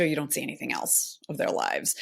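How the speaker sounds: normal talking voice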